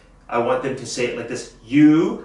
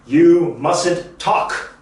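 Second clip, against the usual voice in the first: You mustn't talk, very slow and deliberate; slow model sentence; 3 semitones higher, much slower than usual. Shown as a demonstration. Teacher modelling the sentence slowly for students to repeat. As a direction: drill it slowly so the class can follow. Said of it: "Say it with me".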